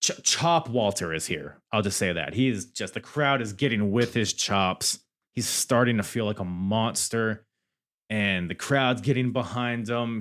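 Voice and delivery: ordinary talk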